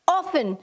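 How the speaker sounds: often said as OFF-un, no T sound; often pronounced without its T; often with silent T. Shown as a demonstration. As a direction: say often like "awfun", the T left silent. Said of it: "तो आपको कैसे बोलना है इसे".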